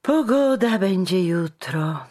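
high, loud words through a yawn; stretched, yawny remark. A narrator's reading. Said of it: "ozwał się ktoś ziewając".

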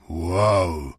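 a low, loud woof; a huge dog answering with a bass woof; deep bark. Acted. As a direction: bark like a big mastiff. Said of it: "ozwał się na to basem Saba".

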